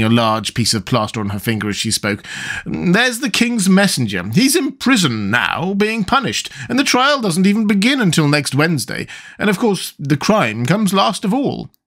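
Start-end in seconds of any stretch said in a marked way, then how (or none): 2.6-11.7 high, unhurried queen's voice. A narrator's reading.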